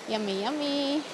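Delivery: ordinary talk, nothing marked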